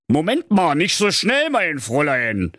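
high character voice, falling at the end; father. Acted. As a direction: halt your daughter with fatherly authority. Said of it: "sprach da der kluge Kombinatsdirektor".

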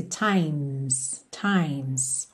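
"Times" low, soft and drawn out, m sliding into s; M straight into S. Shown as a demonstration. Teacher shows learners the ending, M straight to S. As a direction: say "Times" with no vowel between m and s. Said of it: "do M você vai pro S".

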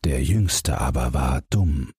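ordinary delivery

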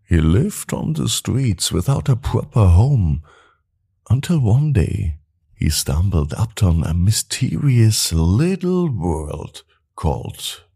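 ordinary delivery, nothing marked

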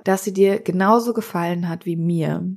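ordinary delivery, nothing marked

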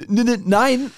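stammering, startled no; nervous stammer. Acted. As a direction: trip over the first sound in a fluster. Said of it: "sagte Laila schnell".

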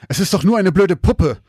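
loud, nasty angry outburst; vicious hiss. Acted. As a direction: spit it at him in anger. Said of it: "zischte er ihn bösartig an".

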